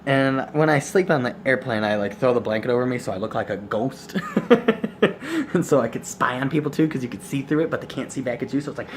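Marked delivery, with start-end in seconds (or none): 4.2-5.5 a high, bubbly giggle breaking out. Real, not acted. Vlogger giggling at her blanket ghost trick. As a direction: giggle at your own silliness.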